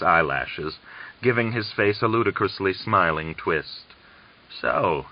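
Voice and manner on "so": croaked. Acted. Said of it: "he croaked".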